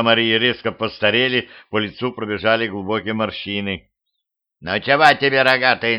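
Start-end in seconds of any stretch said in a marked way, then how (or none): 4.6-5.4 a hint of a cranky hag, creaking the words; lightly voiced, grumpy old woman. A narrator's reading. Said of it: "сварливо проскрипела бабка". 5.4-6.0 a hint of a cranky hag sneering "рогатый"; lightly voiced crone jeering "рогатый"; grumpy creaking old woman. A narrator's reading. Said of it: "сварливо проскрипела бабка".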